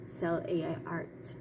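usual speaking voice

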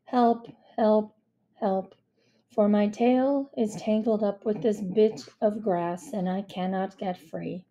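deadpan, uninterested plea; toneless, understated distress; flatly. Acted. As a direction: explain the emergency as if bored by it. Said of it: "said the cat flatly".

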